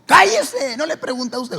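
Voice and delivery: furious mother shutting him up; angry rebuke. Retold or imitated. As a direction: snap him silent. Mother snapping at speaker.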